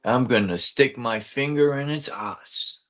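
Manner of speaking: lightly voiced Australian croc hunter; a loose Aussie impression, only half committed; a hint of Australian enthusiasm; Steve Irwin accent. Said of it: "my friend used to do his accent".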